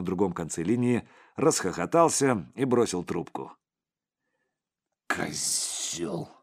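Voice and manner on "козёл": low, quiet curse forced through the teeth; spiteful. A narrator's reading. Boss curses after being hung up on, low and spiteful. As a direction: seethe venomously under your breath. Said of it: "злобно процедил Сипякин".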